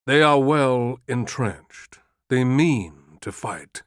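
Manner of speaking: slow and deliberate; measured words, one by one. Acted. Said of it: "He said slowly".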